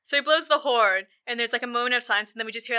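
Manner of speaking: usual speaking voice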